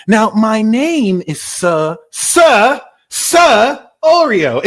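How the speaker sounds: slow, insistent name with Sir punched out; emphatic SIR. Acted. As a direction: announce Sir loudly, drawing out the name. Insisting on his title, Sir.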